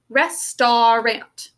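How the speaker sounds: very slow "Restaurant" as res-tar-ant; teacher's syllable-by-syllable "Restaurant"; sounded-out syllables. Shown as a demonstration. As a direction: stretch "Restaurant" into three clear beats. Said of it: "let's sound out the syllables".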